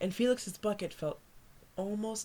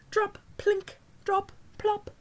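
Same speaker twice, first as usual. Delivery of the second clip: drip sounds; 13 semitones higher, 6 dB louder, slower than usual. A narrator's reading. Drops filling bucket sound.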